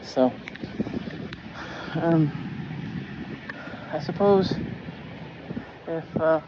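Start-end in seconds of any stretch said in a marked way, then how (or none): none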